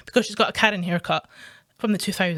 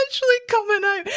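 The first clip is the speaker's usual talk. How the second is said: very high, squeezed through laughter; helpless laughing; 10 semitones higher, faster than usual. Real, not acted. Insisting the Scottish rage is escaping, helpless laughing. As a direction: barely get the words out laughing.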